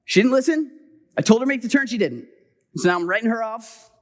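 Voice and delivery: put-on indignant self-justifying; mock dismissive. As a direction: act out washing your hands of someone. Imagined father giving up on child.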